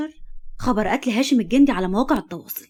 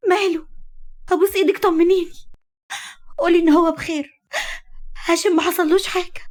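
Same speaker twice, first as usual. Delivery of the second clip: frantic pleading; 7 semitones higher than usual. Acted. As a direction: beg for news in panic. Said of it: "صرخت حبيبة بجنون". (توسل صارخ مذعور)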